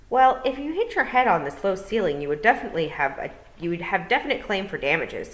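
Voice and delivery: loud, croaky little toad. Acted. Toad apologizing to Tiffany, loud croaky voice.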